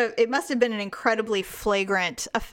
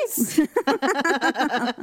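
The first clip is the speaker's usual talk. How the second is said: drawn-out cheer; 4 semitones higher, much slower than usual. Acted. Rallying cheer to her cohost.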